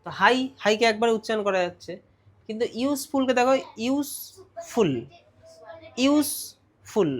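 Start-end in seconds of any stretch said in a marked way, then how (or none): 3.8-4.9 ইউজফুল split in two, ইউজ, then ফুল; syllable by syllable. Shown as a demonstration. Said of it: "দুটো syllable হয়ে গেল". 6.0-7.1 ইউজফুল split in two, ইউজ, then ফুল; syllable by syllable. Shown as a demonstration. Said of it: "দুটো syllable হয়ে গেল".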